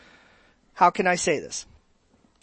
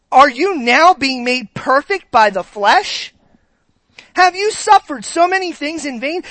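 higher, louder scolding; snarky rebuke; 6 semitones higher, 5 dB louder than usual. Shown as a demonstration. Pastor reading Paul's scolding questions, high and loud. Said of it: "This is filled with snarky".

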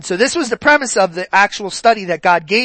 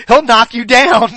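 laughing warning; 2 semitones higher, 7 dB louder than usual. Retold or imitated. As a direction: laugh as you warn him. Retold warning, laughing through it.

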